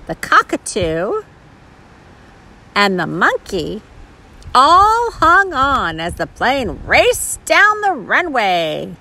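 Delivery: put-on animated reading to a small child, words stretched; picture-book narration. A narrator's reading. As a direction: read it with exaggerated, swooping intonation.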